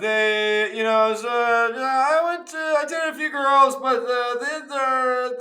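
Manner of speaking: very high, loud, strained stalling; hammed-up panicky excuse-making; flustered dad evasion. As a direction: squirm out of it in a squeaky pitch. Acted dad squirming about past girlfriends.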